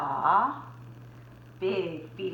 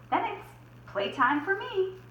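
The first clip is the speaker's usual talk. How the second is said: pig voice; 10 semitones higher than usual. Acted. Pig voiced in read story.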